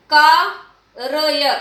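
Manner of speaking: कार्य drawn out as "kaa... ra-ya"; wrong split pronunciation. Shown as a demonstration. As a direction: split कार्य into slow separate syllables, full vowel after the r. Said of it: "अशुद्ध शब्द है बच्चों".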